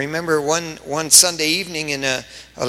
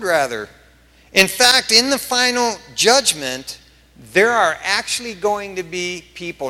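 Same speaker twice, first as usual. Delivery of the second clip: high, raised pulpit voice; emphatic preaching; 7 semitones higher, 6 dB louder than usual. Real, not acted. Pastor warning of false assurance at judgment, emphatic preaching. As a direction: drive the warning home to the congregation.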